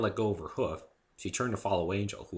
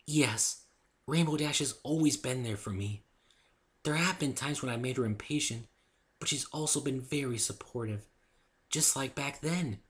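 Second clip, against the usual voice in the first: gentle Fluttershy voice; 6 semitones higher than usual. Acted. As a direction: speak sweetly and timidly.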